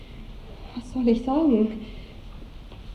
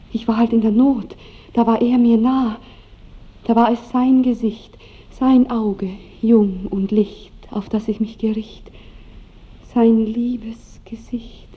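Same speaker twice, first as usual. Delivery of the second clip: rapturous confession; 4 dB louder than usual. Acted. Young woman confesses rapture to lover.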